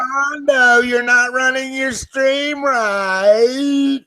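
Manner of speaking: shouting. Real, not acted. Yelling at streamer.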